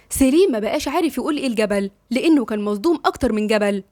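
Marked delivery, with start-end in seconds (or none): none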